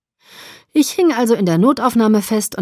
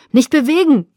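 loud, higher barked order; commanding; 7 semitones higher, 7 dB louder than usual. Acted. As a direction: command it across the scene. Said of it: "kommandierte jemand".

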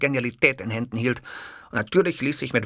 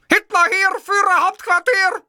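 very high, loud yell; screaming; 7 dB louder than usual. A narrator's reading. Man yelling into the phone after answering, screaming. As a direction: scream the announcement at full force. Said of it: "ich schrie".

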